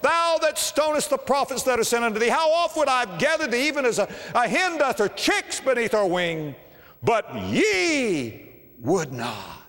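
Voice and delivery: weeping lament. Acted. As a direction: cry out over the city in grief. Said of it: "Jesus is weeping, and he's saying".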